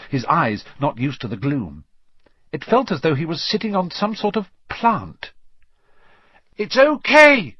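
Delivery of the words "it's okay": high, loud, drawn-out call. A narrator's reading. Harry calls up to the trapdoor from below, calling out. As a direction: call it upward to someone far above. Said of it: "he called up to the light".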